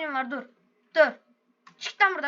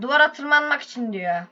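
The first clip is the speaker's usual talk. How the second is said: ordinary talk, nothing marked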